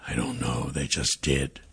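low, soft, defeated murmur; sighing resignation. A narrator's reading. Captive father has no answer for son, resigned sigh. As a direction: answer wearily under your breath. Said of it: "The father sighed, shaking his head as he said".

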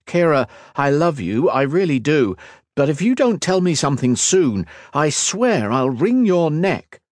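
high, forceful, impatient threat; exasperated. A narrator's reading. Kat threatening Kira for answers, exasperated. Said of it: "patience was being stretched to the limit".

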